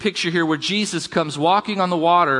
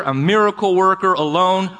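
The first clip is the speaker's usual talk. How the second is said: ordinary talk, nothing marked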